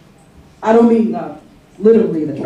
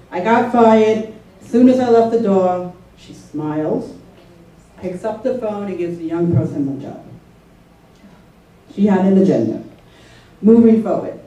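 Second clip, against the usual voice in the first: usual speaking voice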